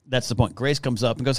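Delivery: usual speaking voice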